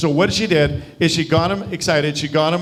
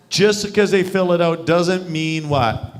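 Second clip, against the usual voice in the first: slowed right down and louder, "what?" left for the group; slow prompting repeat; 5 semitones higher, 3 dB louder, slower than usual. Shown as a demonstration. Coach quizzes the group on the booking rule, slow prompting repeat. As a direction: drill "Just 'cause they fill it out" slowly, prompting an answer.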